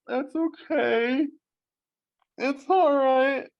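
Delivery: put-on high, slow, teary little-boy voice; crying little boy. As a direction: imitate a brave kid holding back tears. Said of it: "he's sitting there going".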